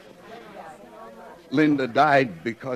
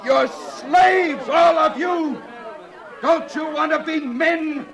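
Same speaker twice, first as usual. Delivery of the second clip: furious oration; impassioned harangue; 13 semitones higher than usual. Acted. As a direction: rouse the crowd. John harangues crowd.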